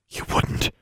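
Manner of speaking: soft, voiceless disbelief; whispered. A narrator's reading. Frightened girl doubts the bully's threat, whispering. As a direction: whisper it, barely audible. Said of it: "she whispered".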